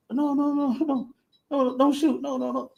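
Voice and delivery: high, soft begging no no no; mock pleading hostage. Mock hostage begging captor not to shoot, high and soft. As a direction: plead for your life, lightly hammed.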